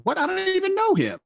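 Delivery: very high, indignant squeal of disbelief; retold high-pitched outburst; shocked protest. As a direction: act out an appalled daughter.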